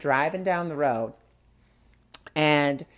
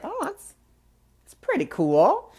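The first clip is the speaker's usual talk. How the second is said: pleasant surprise; 12 semitones higher, 4 dB louder than usual. Retold or imitated.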